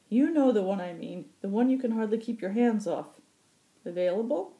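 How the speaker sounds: high, loud, sly gruff prodding; sly old pilot. Acted. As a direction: prod him with a knowing leer. Occam voiced, prodding Hakon.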